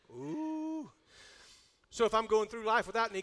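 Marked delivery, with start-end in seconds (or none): 0.1-0.9 soft, high, long "ooh"; drawn-out ooh. Real, not acted. Reacts to his hard point.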